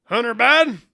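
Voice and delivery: high, loud disbelieving repeat; hammed-up shock; incredulous outcry. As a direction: blurt the name out, loud and high.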